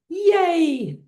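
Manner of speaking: high, long-held cheer. Shown as a demonstration. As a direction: sing out a high celebratory yay.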